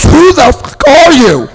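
slow, high, loud punchline shout; astonished yell. Retold or imitated. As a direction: bellow it in hammed-up disbelief. Newcomer's astonished reaction, punchline.

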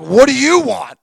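loud, high impatient shout; irritated yell. Retold or imitated. Jail guy to the man returning, irritated yell. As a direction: bark it at a nuisance.

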